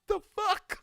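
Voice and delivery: high, loud stunned cry; shocked outburst. Retold or imitated. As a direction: blurt out disbelief. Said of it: "I said".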